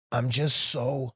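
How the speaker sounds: real emotion creeping into the retelling; getting emotional. Real, not acted. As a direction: stretch it out as feelings catch up with you. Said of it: "I'm getting emotional thinking about it now".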